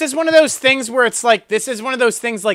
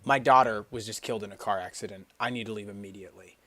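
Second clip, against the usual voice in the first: much softer, lower, hushed and grave; mock solemn; 12 semitones lower, 15 dB quieter than usual. Scammer faking grief to leave, low and hushed. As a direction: hush it like tragic news. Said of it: "he could say".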